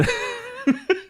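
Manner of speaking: high squeaky laugh. Real, not acted. Amused by own accent.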